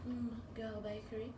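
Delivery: lightly mimicked posh British questioning; softly put-on British accent. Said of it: "with your highest British accent".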